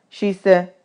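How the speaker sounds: plain reading voice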